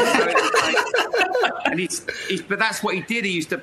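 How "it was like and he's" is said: breaks into high laughter mid-phrase; drawn-out laughing giggle. Real, not acted. Telling a podcast host about training, laughing.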